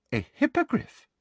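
squeaky high surprise; a prim, shocked little query; high surprised question. A narrator's reading.

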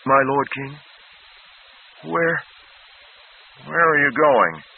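put-on a scared child king pleading; boy panicking as his advisor leaves; frightened boy. A narrator's reading. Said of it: "frightened boy calls after Flagg".

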